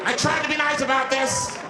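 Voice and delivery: high, strained outburst; flipped-out warning. Acted. Cornered man warning the bus, high frantic outburst. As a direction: protest at a high pitch, at the end of patience. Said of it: "the guy flips out".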